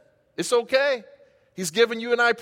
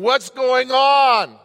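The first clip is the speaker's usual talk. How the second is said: worried wondering; 4 semitones higher, 7 dB louder, much slower than usual. Retold or imitated. Worried believer wondering aloud.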